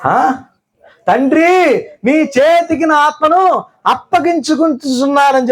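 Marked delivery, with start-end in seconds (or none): 1.1-5.5 higher, lightly raised last cry; a hint of crying out to the Father; understated final cry, pitched up; mild mock loud cry. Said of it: "బిగ్గరగా కేక వేసి".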